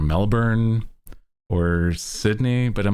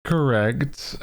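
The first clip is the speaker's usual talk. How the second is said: ordinary talk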